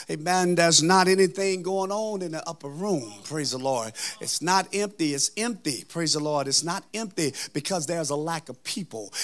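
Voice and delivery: usual speaking voice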